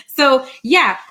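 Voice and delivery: ordinary delivery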